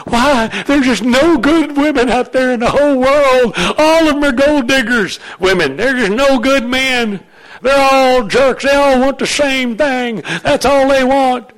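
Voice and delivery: hammed-up lament about the dating pool; whiny complaint. Pastor mimicking singles griping about no good men or women, exaggerated complaint.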